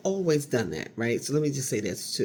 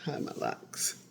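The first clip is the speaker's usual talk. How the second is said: whispered; 8 dB quieter, slower than usual. Real, not acted. Musing aloud about hiding thinning locks.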